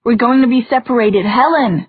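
shouting a name across chaos. Acted. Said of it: "Julian shouted".

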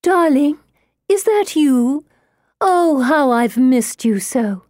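high, gushing spirit-mother voice; sweet as prayer song. A narrator's reading. Medium poses as the dead mother greeting her child, high sugary cooing. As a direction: coo it high and drawn out. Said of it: "deep growl grows sweet as prayer song".

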